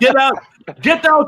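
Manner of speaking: loud high yell to leave; shouted get out. Retold or imitated.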